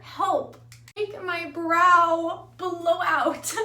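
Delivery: mock plea. Vlogger jokes she can't speak English, mock pleading. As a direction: drag it out like a comic cry for help.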